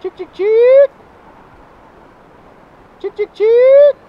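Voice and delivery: loud high "chick chick chick" call; calling chickens. Real, not acted. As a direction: call the stray chick from far off. Calling a silkie from afar.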